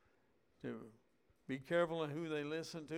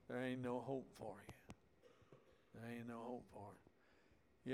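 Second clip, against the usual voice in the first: softer, fading verdict of no hope; hopeless murmur; 4 semitones lower, 8 dB quieter than usual. Acted. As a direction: let it sink, softer each time. Preacher voicing despairing verdict.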